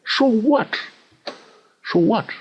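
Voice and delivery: high-pitched, shrugging so what; dismissive so what. Real, not acted. Coach tells traders a missed trade doesn't matter, dismissive. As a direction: toss it off, who cares.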